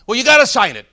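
acted argument, digging in; insistent teller. Teller arguing in acted quarrel.

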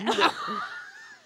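very high, loud laugh; high cackle; shrieking laugh. Real, not acted. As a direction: burst out in a squeal of laughter. Cracks up at the joke.

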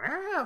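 high, short headbanger yell; mock rock yell. Imitating [name] headbanging, mock yell. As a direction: yelp like a singer jumping on stage.

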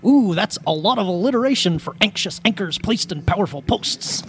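lightly voiced Albert Brooks; a hint of anxious Albert Brooks; Albert Brooks impression. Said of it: "my Albert Brooks impression".

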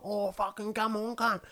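rough Queensland bogan voice; lightly mocked Queensland bloke; Queensland bogan accent. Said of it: "And the Queensland, like".

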